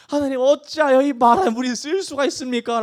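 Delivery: high-pitched, pleading lament; crying out to God. Acted. Preacher as Moses crying out to God, pleading. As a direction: wail the question up to heaven. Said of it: "막 그렇게 부르짖었더니".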